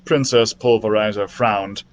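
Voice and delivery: usual speaking voice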